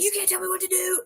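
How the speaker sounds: high, fast, whiny defiance; mock bratty yell at a bartender; petulant shout. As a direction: mimic a tantrum-throwing man. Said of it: "And he was like".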